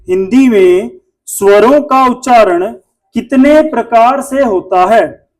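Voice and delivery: slow, word by word, for writing down; slow dictation. Shown as a demonstration. Teacher dictating a question to students, slowly. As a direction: dictate it slowly so they can write. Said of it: "एक छोटा सा question लिखो".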